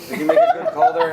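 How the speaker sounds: laughing. Real, not acted. Teasing friend while laughing.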